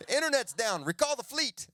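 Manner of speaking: high, loud military alarm; mock emergency order. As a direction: call out a hammed-up urgent command.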